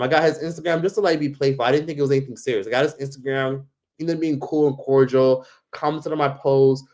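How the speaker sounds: normal talking voice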